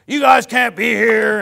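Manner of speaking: mock angry shout. Retelling old guy ordering soul winners away, imitated angry shout. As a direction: shout them off the property in a put-on voice. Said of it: "starts telling them".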